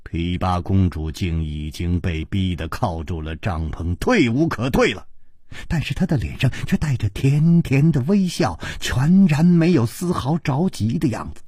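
ordinary delivery, nothing marked

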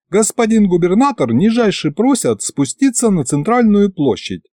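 bow and scrape as you speak; a lightly voiced fawning imp; fawning little imp. A narrator's reading. Imp delivers message.

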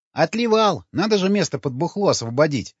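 high-pitched, cold-bitten character voice; shivering with cold. A narrator's reading. Man back from the cold answers mates, voice shivering. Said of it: "Дрожащим от холода голосом ответил Вячеслав".

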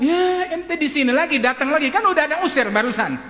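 loud, high-pitched acted scolding; exasperated outburst. Father chasing off the returning teacher, exasperated and angry. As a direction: burst out at a visitor who keeps returning.

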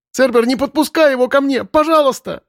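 put-on a terrified girl's shriek of a plea; frightened squeal. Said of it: "голос сорвался на испуганный и беспомощный визг".

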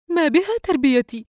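high, loud offended question; indignant retort. Acted. Mother answering her husband's jab, high and loud with indignation. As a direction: fire it back at him, stung.

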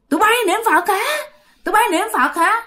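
high, jeering demon voice mocking the praying women; taunting ghost. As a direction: sneer it down like a spirit on the roof. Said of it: "niệm gì mà vừa la, vừa chửi, vừa cười".

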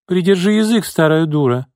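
ordinary delivery, nothing marked

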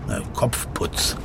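normal talking voice

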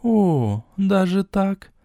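surprised. A narrator's reading. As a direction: react in astonishment. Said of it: "с изумлением воскликнула Хель".

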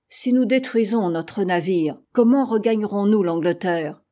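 plain reading voice

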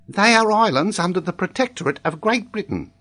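put-on high, matter-of-fact child's answer; confident schoolboy. A narrator's reading. Boy answers the examiner confidently, high schoolboy voice. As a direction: state it like a learnt lesson.

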